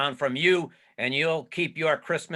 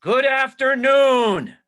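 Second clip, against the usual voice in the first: much higher and louder, slow, each word hammered out; furious dismissal; 10 semitones higher, 8 dB louder, slower than usual. Acted. Scrooge roaring the nephew out of his office, furious and slow. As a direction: end this visit now, slowly and loudly.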